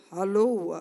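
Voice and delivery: low, loud hello in a man's voice; impressive male voice. Acted. Man answering the pastor's phone, deep impressive voice. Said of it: "que voz".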